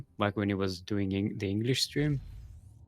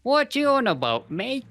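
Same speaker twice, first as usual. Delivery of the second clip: high, hammed-up Australian accent; mock Australian accent; 9 semitones higher, slower than usual. Streamer imitating the Aussie caster, hammed-up Australian accent. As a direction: play an offended Aussie caster, overdone Australian. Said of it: "He doesn't sound like an Aussie".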